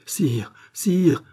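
high, pleading call; desperate cry. A narrator's reading. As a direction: appeal to the king in distress. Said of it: "s'écria André".

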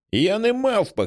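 put-on high tearful child's shout; high shrieking child on the verge of tears; crying shout. A narrator's reading. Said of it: "кричить Піпа і починає плакати".

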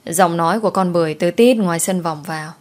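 ordinary delivery, nothing marked

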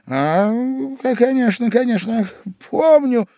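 high-pitched, eager stammer of assent; flustered agreement. Acted. Husband pretends to remember the stranger, flustered. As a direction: agree hastily, voice climbing high.

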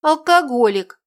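barked, high and loud; angry bark. Acted. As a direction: snap it at him in anger. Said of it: "рявкнул на него Витка". (гневный окрик)

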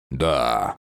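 low, weighty growled yes. A narrator's reading. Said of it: "прорычал он весомо".

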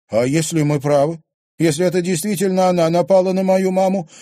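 high, loud upset pleading. A narrator's reading. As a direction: press him, hurt and upset. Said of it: "расстроенно спросила Луиза".

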